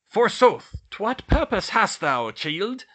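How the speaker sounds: loud, high archaic character voice; thirteenth-century portrait. A narrator's reading. Medieval portrait challenges a visiting pupil, loud and archaic. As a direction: demand it grandly in Middle English style. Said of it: "she rather sounded like it".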